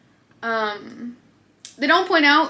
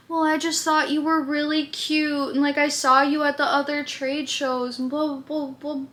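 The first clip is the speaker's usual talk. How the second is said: higher, softer mock imitation of him; mocking the guy; 4 semitones higher, 4 dB quieter than usual. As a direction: parody his lame excuse, trailing off. Mocking the flirting man's excuse.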